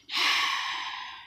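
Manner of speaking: long breathy sigh; heavy drawn-out sigh. Real, not acted. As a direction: let out a long voiceless exhale. Awkward goodbye to possible viewer.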